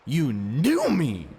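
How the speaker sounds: high, loud, slow accusing cry; a girl's outraged cry; furious accusation. Acted. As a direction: hurl it at him. Ani confronts Ivan, furious.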